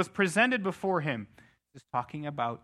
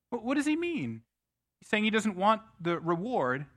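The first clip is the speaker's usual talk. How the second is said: ordinary delivery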